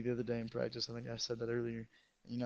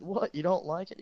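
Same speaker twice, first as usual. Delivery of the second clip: higher, louder indignant question; mock offense; 5 semitones higher, 5 dB louder than usual. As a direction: challenge the coach. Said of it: "And I said".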